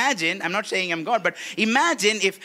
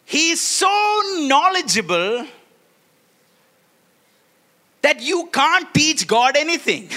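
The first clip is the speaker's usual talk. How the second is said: laughing proclamation; 5 semitones higher, 3 dB louder than usual. Acted. Preaching with laugh.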